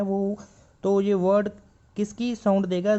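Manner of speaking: usual speaking voice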